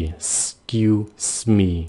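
slow broken-up "'scuse me"; two hissed s sounds. Shown as a demonstration. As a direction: EXCUSE as "s-kyu-s", very slow. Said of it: "hai cái xì".

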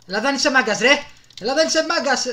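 high, loud jeering at the enemy; taunting shout. Real, not acted. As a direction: yell a taunt at the rival. Shouting at opponent in game. (δυνατό πειραχτικό φώναγμα)

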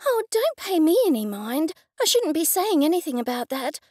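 high, flustered woman's voice; nervous flurry. A narrator's reading. Rebecca backtracking to Sybil after her chatter, nervous flurry. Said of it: "waved her hand in a nervous flurry".

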